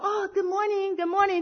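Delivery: high, light and cheerful hello; breezy greeting. Retold or imitated. She greets the nervous supervisors, airy and cheerful. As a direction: sing out a carefree good morning. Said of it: "我看每个人说".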